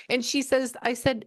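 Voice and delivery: normal talking voice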